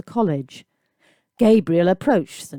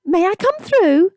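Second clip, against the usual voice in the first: high, louder camp posh mimicry; mocking posh camp accent; 13 semitones higher, 5 dB louder than usual. A narrator's reading. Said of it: "in an exaggeratedly posh, rather camp accent".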